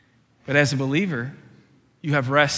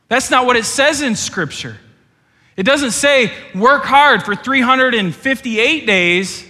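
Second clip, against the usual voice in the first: raised, high preaching voice; forceful preaching; 6 semitones higher, 7 dB louder than usual. Acted. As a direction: challenge the congregation with force.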